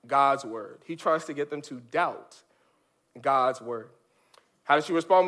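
ordinary talk

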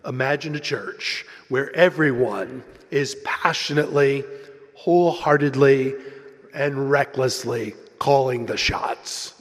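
intoning promo announcer, punching each adverb; deep-throated announcer. Retold or imitated. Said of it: "requisite deep-throated announcer intoning".

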